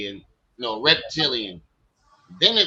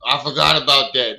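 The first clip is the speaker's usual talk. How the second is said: ordinary delivery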